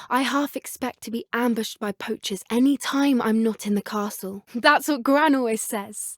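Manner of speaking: usual speaking voice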